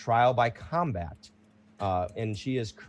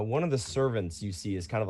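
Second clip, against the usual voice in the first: ordinary talk, nothing marked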